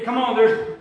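ordinary talk

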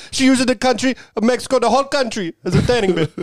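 high, laughing fake Russian accent; mock Russian accent, joke delivery; bad Russian accent. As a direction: ham up a Russian accent Borat-style. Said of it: "You kinda went Russian".

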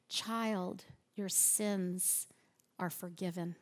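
soft and low refrain; tender. Shown as a demonstration. Preacher offering forgiveness to the struggling listener, tender. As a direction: lay the words down gently.

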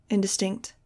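ordinary delivery

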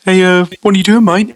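high cartoon voice in Big Lez Show accent. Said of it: "try and get the accent right".

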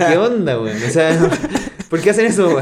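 amused outrage at ahorita; laughing exasperation. Real, not acted. Laughing mock exasperation at Mexicans.

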